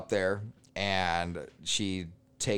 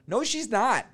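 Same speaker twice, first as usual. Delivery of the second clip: high-pitched mock denial from the other woman; mock indignant woman; 12 semitones higher, 3 dB louder, slower than usual. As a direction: squeak it out like the offended rival. Other woman denies being girlfriend.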